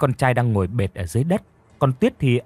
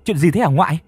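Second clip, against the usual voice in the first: anxious; 4 semitones higher, 3 dB louder than usual. A narrator's reading. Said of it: "rối rít hỏi".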